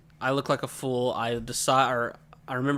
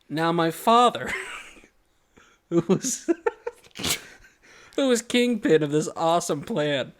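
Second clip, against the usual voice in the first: ordinary delivery, nothing marked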